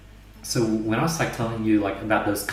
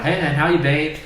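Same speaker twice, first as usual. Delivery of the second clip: breezy, higher and louder buddy greeting; mock casual greeting; 6 semitones higher, 6 dB louder than usual. Boss greeting him in a higher, louder, mock nonchalant voice. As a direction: toss it off like an old pal.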